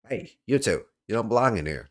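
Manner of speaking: a loud, lightly acted telling-off; lightly voiced suspicious caretaker.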